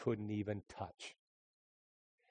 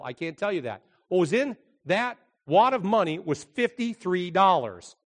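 raised, driving preacher voice; preaching; 6 semitones higher, 7 dB louder than usual. Acted. Pastor preaching to the church, raised and driving. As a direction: preach it out to the congregation.

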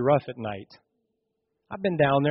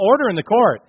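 raised, higher judge's command; judge calling order; 7 semitones higher, 8 dB louder than usual. Retold or imitated. Judge to the young defendant, a commanding call.

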